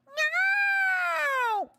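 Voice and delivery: very high, loud, drawn-out copycat wail; mimicking squeal. Streamer copying her cute coworker's cry, long mimicking squeal. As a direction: parrot the cute squeal right back. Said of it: "people would think, "Oh, you're mocking them".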